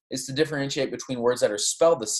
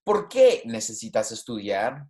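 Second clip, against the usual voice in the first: POR QUÉ pitched up, then down for the rest; rising on question word; 3 semitones lower than usual. Shown as a demonstration. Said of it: "my voice going up".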